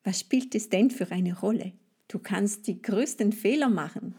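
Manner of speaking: ordinary talk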